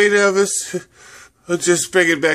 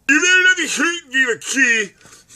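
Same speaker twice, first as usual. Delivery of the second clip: high squeaky warning; 9 semitones higher than usual. Acted. Character warns in high voice.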